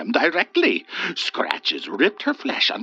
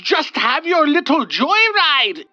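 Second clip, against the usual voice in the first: squealing in anguish; 12 semitones higher, 5 dB louder than usual. Acted. The wraith squealing in anguish at Olga. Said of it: "it squealed in anguish and fury".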